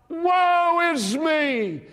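high, drawn-out anguished cry; Isaiah crying out. Acted. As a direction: wail it slowly as the prophet undone. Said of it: "he cried out".

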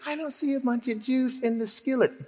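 imitating a skeptical cook at the skillet; doubtful onlooker. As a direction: peer into the pan unconvinced. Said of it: "Somebody looks in the skillet and goes".

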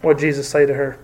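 normal talking voice

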